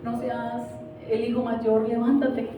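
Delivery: soft, earnest exhortation over music; preaching over music. Acted. A quiet pastor pleading with the men to rise in prayer. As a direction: pastor urging the men to pray.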